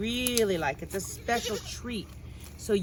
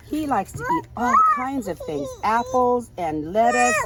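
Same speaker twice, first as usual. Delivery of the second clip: much higher and louder; excited; 5 semitones higher, 12 dB louder than usual. Real, not acted. Mother gushing to children about the pig.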